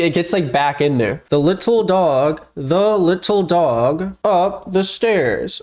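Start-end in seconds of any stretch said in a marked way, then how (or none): 1.3-5.6 slow, careful words with the lips barely moving; slow ventriloquist drill. Shown as a demonstration. Practicing alone, sounding out a ventriloquist line slowly. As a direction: drill each word slowly, mouth almost shut. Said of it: "the P is tough".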